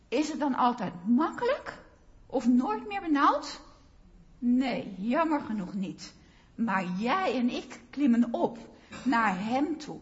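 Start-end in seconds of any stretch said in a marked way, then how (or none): none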